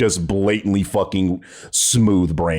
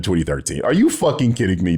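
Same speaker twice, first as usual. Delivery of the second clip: ordinary talk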